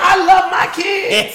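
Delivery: high, loud, slow, laughing; mock Kev. Friend imitates Kev declaring fatherhood, high and loud. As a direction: ham up Kev's proud dad line, high and slow. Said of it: "I'm a father".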